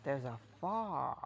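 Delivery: "fire" drawled slowly as "far" in a country accent; American drawl. Shown as a demonstration. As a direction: stretch it out into a lazy Southern "far". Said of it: "instead of saying it like that".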